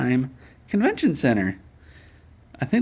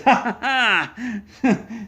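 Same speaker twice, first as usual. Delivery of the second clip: mock villain laugh; 9 semitones higher, much slower than usual. Thief laughs running off.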